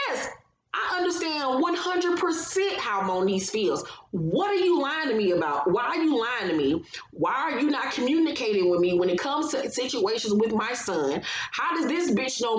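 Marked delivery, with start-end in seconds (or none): none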